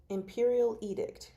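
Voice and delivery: ordinary talk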